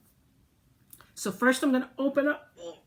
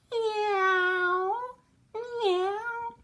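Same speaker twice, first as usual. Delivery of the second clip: high mock cat meows; playful meow; 10 semitones higher than usual. Teacher showing her drawn cat to kids, playful high meow. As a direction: meow like a cartoon kitty for the kids.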